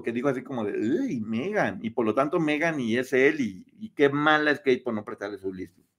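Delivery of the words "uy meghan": drawn-out, softer grossed-out reaction; mock disgust. Kate recoiling at Meghan's request, mock disgust. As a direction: draw it out with mild revulsion. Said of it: "le dio como asco".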